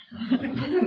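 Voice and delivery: short amused laugh; light laugh. Real, not acted. Reader laughing at her Jake Gyllenhaal joke. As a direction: laugh at your own joke.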